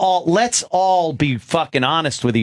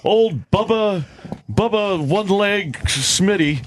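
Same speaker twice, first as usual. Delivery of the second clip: higher mock-mournful obituary voice, drawn phrase by phrase; mock Earl announcing deaths; 3 semitones higher than usual. Host mocking Earl's musician obituaries, mock-mournful. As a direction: imitate someone solemnly breaking news of a death. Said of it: "He announces dead p".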